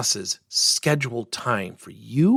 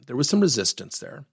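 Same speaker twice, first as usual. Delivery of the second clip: ordinary talk, nothing marked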